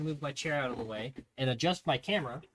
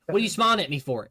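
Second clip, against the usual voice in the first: fast, louder demand; drill sergeant bark; 4 semitones higher, 7 dB louder, much faster than usual. Retold or imitated. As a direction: snap at the smiling recruit. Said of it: "So he's like".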